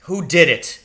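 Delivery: loud, high demand; shouted. A narrator's reading. Father shouts at his son to learn who hurt him. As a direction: shout it in anger. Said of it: "he shouted".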